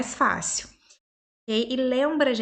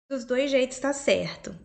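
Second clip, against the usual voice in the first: ordinary delivery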